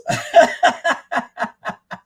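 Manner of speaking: loud, high cackle; high, delighted cackling; real laughter. Real, not acted. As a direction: laugh hard. Laughs at meme.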